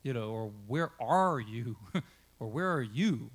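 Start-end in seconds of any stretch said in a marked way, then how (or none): 0.7-1.8 ARE lifted and stretched out; stress on ARE. Shown as a demonstration. Preacher showing how emphasis changes meaning, stress on "are". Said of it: "you can emphasize different, the different words". 2.5-3.3 YOU lifted and drawn out at the end; stress on YOU. Shown as a demonstration. Preacher showing how emphasis changes meaning, stress on "you". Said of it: "you can emphasize different, the different words".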